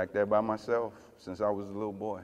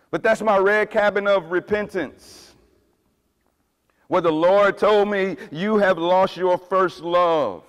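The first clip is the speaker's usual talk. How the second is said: loud, high preaching voice; full-force preaching; 5 semitones higher, 9 dB louder than usual. Acted. Pastor preaching loudly about his red cabin. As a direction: drive the point home.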